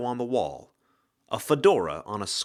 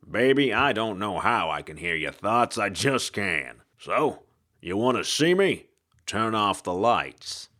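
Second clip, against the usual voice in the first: hard-boiled ghost. Acted. Ghost detective voice.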